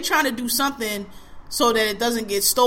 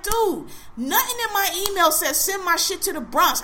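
indignant retort; 8 semitones higher than usual. Retold or imitated. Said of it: "I was like".

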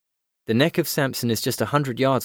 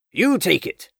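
high-pitched barked order; urgent shout; 9 semitones higher, 4 dB louder than usual. A narrator's reading. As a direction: shout the order across to them. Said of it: "the Chief was shouting".

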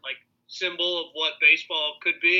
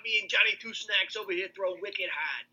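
put-on higher Boston fan accent; 5 semitones higher than usual. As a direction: keep the Boston accent going. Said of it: "I'd be like".

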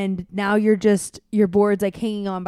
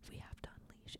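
whisper; 29 dB quieter than usual. Retold or imitated. Dad voicing his own dire surf decision, whispered. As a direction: breathe it like a terrible secret. Dad quoting himself, grave moment.

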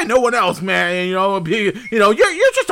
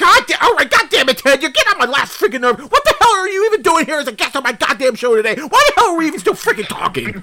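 losing patience; 5 semitones higher than usual. Acted. Host ranting at the guest who grates on him. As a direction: rant at the guest, nerves gone.

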